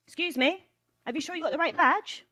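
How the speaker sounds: high, loud, put-on questioning voice; doubting event stranger. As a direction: mimic someone politely challenging a guest.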